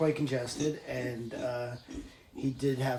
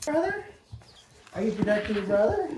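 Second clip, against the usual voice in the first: much higher fast sing-song; pet talk; 8 semitones higher, faster than usual. Real, not acted. Asking the pig if she protects her brother, high coo.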